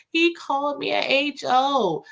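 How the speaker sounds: high mocking voice, slowed down; Danny mocked as she tells Sabrina her lies, high and slow; mocking whine. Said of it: "making up".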